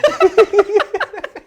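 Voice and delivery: loud high-pitched laugh; high burst of laughter. Real, not acted. Loud laugh after joke.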